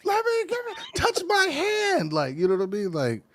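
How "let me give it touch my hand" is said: high-pitched mock fangirl squeal; hammed-up, piercingly high adoration; screaming fan. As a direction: squeal like a hysterical fan begging for contact. Plays fans rushing a teen star.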